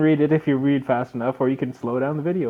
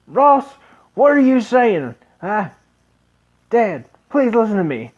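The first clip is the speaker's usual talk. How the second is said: put-on higher, louder young man's voice; eager young son; 3 semitones higher, 5 dB louder than usual. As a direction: plead with his dad, fired up. Voicing the son Ross.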